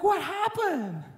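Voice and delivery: high, slow, surprised What happened with a laugh; a father playfully shocked at his kids; half-laughing high dad; mock surprised dad. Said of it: "I'm like".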